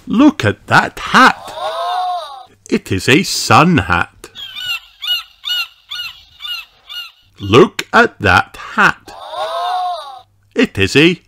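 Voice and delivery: put-on read-along for children. A narrator's reading. Reading a hat book to kids, lilting sing-song. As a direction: bounce through each hat line.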